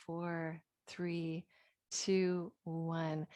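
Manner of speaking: soft, slow breathing count; guided breath count. Acted. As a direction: count the breaths gently and slowly.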